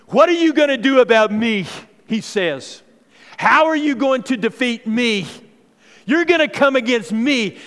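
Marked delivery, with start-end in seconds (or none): 0.1-1.7 loud, high mocking challenge; a booming giant jeering the army; giant's taunt. Goliath taunting the Israelites. 3.4-7.6 loud, high mocking challenge; a booming giant jeering the army; giant's taunt. Goliath taunting the Israelites.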